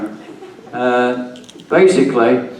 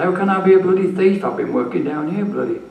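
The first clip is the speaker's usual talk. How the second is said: indignant outburst; 8 semitones higher than usual. Retold or imitated. Retold angry protest to boss.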